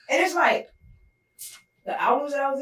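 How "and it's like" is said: ordinary talk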